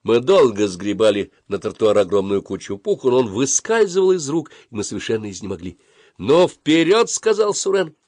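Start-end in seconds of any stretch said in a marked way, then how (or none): 6.2-7.1 high, loud urging; rallying call. A narrator's reading. Suren urges his friends on, rallying.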